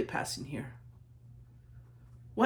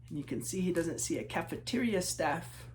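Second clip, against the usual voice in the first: ordinary delivery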